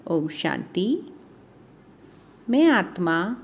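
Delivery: measured meditation voice with long pauses between phrases; guided meditation. Acted. Guiding listeners through a Navratri meditation on divine powers, meditative. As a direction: lead a meditation, phrase by phrase, unhurried. तो चलिए start करते हैं meditation.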